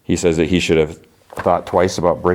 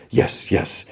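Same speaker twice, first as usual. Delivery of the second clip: hushed, airy reply to a voice in the head; haunted muttering; 2 semitones higher, 4 dB quieter than usual. Retold or imitated. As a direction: answer softly, as if haunted. Haunted Maul answering the voices.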